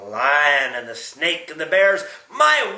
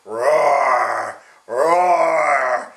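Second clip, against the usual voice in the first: roaring lion; 7 dB louder, much slower than usual. Acted. Grandpa roars as the lion, growing to a loud shout. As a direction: build the roar bigger each time. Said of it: "It goes".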